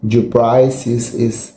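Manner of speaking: ordinary talk, nothing marked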